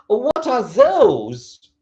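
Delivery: THOSE high, loud and very drawn out; emphasis on THOSE. Shown as a demonstration. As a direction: point far off with a long THOSE. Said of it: "changing intonation and changing a bit, uh, the emphasis".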